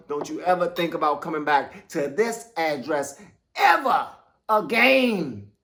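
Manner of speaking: ordinary talk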